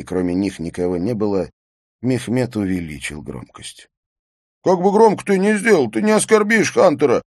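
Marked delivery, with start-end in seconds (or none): 4.6-7.2 put-on high, loud drunken shout. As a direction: yell it over the music. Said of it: "прокричал Эйстейн".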